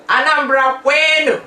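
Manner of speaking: loud, high Anambra Kwenu cheer; rallying cry. Real, not acted. Hailing her home state with a loud proud cheer. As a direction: shout it out like a proud rally call.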